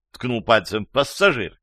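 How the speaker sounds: plain reading voice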